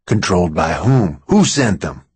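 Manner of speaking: lightly voiced man, pressing; questioning Max. A narrator's reading. Max challenges Mary's claim, puzzled and questioning. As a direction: question her in a man's register.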